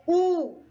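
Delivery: a lone "u", held long; stressed vowel lengthened. Shown as a demonstration. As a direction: hold out "u" as the stressed syllable. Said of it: "eu me demorei mais nessa palavrinha".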